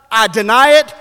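high, loud, emphatic denial; intensified denial. Acted. Peter keeping pace with his accusers, loud emphatic denial. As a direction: throw the charge back forcefully. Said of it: "so does the nature of the denials".

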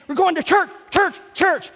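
high, excited little cheer; gleeful anticipation. Acted. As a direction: chant it eagerly on the way. Rejoicing world heading to church.

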